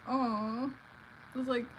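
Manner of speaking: mock-sympathetic coo; teasing baby-talk sympathy; needling. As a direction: coo with fake pity to annoy her. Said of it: "know how to get under someone's skin".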